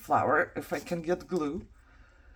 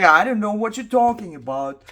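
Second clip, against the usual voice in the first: husband's baffled reply, a bit louder; mock clueless husband; 2 semitones higher, 8 dB louder than usual. Wife imitating her husband not understanding her, mock clueless. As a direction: play the husband who doesn't get it, with a louder push. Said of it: "And you're like".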